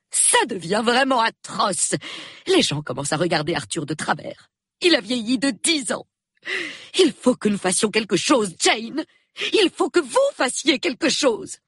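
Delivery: high-pitched, urgent lament; distraught pleading. A narrator's reading. Wife begs Miss Marple to act, high and distraught. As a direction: plead with rising desperation, pitched high and insistent.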